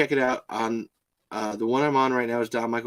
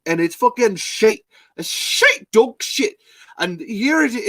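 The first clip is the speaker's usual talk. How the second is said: badly faked Irish rant, pitched up; terrible Irish accent; 5 semitones higher, 4 dB louder than usual. Host quoting Colin's complaint about the show, bad Irish accent. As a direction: attempt an Irish accent and overshoot it. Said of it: "that was a terrible impression".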